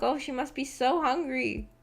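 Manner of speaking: normal talking voice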